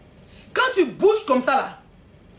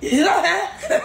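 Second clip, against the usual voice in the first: mimicking an advert; 6 semitones higher, slower than usual. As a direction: parody a commercial catchphrase with amusement. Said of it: "Qui se rappelle des publicités là?".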